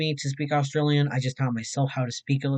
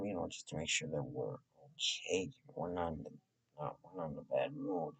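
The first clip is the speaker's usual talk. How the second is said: hushed murmur, barely voiced; whisper; 11 semitones lower, 17 dB quieter than usual. Real, not acted. Streamer murmuring to his viewers, whisper. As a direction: drop to a near whisper, confiding.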